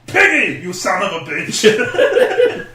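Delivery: loud high mock action-hero cry, then drawn out; Swedish Chef as Arnie. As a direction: curse in a hammed-up Austrian accent. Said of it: "Swedish Chef as Arnie".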